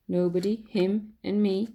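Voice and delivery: ordinary delivery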